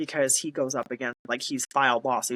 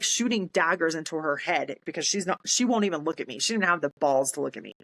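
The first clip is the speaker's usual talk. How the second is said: usual speaking voice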